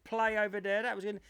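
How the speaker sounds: high-pitched mock congratulations; gushing show host. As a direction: imitate a host praising the guest. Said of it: "They're like".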